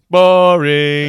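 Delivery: loud bored heckle; yelling out. Retold or imitated. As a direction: heckle it loudly from the crowd. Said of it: "Just Homer yelling out".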